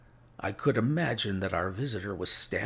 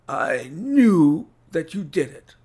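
loud, high, strained accusation; accusing sick man; 7 semitones higher, 11 dB louder than usual. A narrator's reading. Sick Holmes accusing his visitor, strained high outburst. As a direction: force it out with an invalid's effort.

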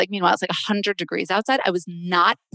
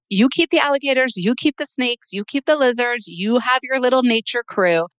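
higher-pitched, teasing list; playful dismissal; 6 semitones higher, 3 dB louder than usual. Real, not acted. Snake-hater leaving wildlife to her friend, playfully dismissive. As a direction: hand it all over, wanting no part.

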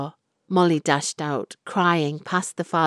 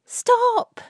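much higher, louder cry of fury; furious cry; 5 dB louder than usual. A narrator's reading. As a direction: cut him off at full voice. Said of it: "Florrie wept in fury".

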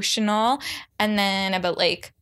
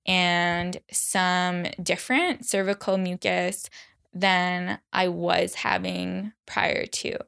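normal talking voice

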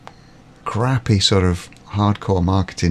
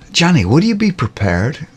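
high, mock American sales voice; started American accent, then dropped; 7 semitones higher than usual. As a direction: put on a salesy American twang. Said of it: "I'm not gonna do an American accent".

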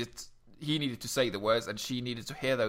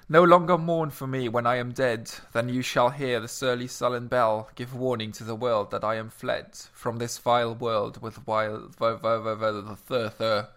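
measured recital of a sonnet; poem reading; 5 dB louder than usual. Acted. Reading a sonnet to the podcast audience, measured recital. Reads Sonnet seventy-one aloud.

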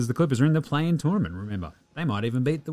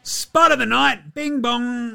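high, slow, loud title call; segment announcement; 10 semitones higher, 4 dB louder, slower than usual. Acted. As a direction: announce it like a game show host.

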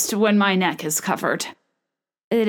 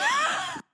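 high squeaky laugh; 6 dB quieter than usual. Real, not acted. Laughs at own pun.

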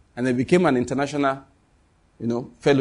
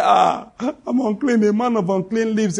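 Isaiah; 7 semitones higher, slower than usual. Acted. Isaiah confessing before God, high drawn-out lament. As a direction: wail it out slowly, pitched well up. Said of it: "Isaiah came and said".

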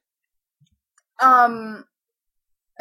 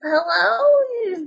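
put-on high, drawn-out old-lady hello; 6 semitones higher than usual. Old lady answering a call about her lost dog, high-pitched. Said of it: "it's this old lady".

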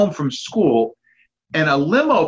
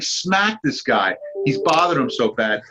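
normal talking voice

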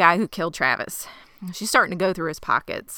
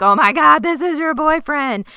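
high, loud disbelief, slowed; oh my God, stretched out in shock; shocked gasp; 9 semitones higher, 8 dB louder than usual. Retold or imitated. Ty realising the dead man is her boyfriend, shocked. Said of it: "Ty's like".

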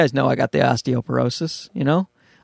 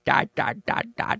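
imitating baby babble; 6 dB quieter than usual. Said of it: "he's going".

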